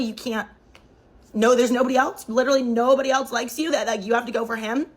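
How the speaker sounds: usual speaking voice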